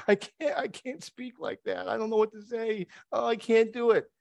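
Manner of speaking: high-pitched mock reluctance; helpless protest. Moses making excuses to God, high helpless protest. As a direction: plead helplessly in a high voice, hammed up.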